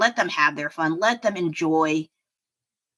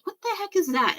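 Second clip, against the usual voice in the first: mock bewilderment, pitched much higher; baffled reaction; faster than usual. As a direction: act out a puzzled, high-pitched double take. Acts out own baffled reaction.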